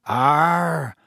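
long drawn-out dark "arr"; gruff, ominous driver's grunt; dark agreement. A narrator's reading. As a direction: agree darkly in one drawn-out growly word. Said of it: "said Ern darkly".

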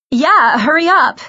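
high, loud, stretched insistence; impatient urging. A narrator's reading. Girl rushing her friend into costume, impatient.

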